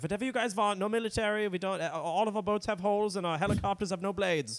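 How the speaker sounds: high-pitched mock German accent; Germany personified. Germany disowning its military, comic German accent. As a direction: play an eager-to-please German, pitched well up. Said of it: "Germany for so long has been like".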